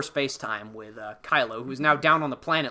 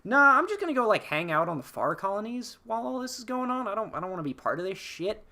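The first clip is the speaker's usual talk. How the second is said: mock breezy brush-off, much higher; dismissive; 8 semitones higher than usual. Podcaster voicing Zori turning down Poe, a light, airy brush-off. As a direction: airily wave off the whole war, speeding up at the end. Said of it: "and she's like".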